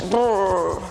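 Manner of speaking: cold shiver sound; shivering brrr. Shown as a demonstration. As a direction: shiver through the lips. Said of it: "please show me your cold".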